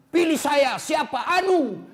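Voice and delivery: high, loud hammed-up candidate pitch; mock campaigning candidate. Candidate calling to voters, hammed-up and loud. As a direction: ham up a nameless candidate begging votes.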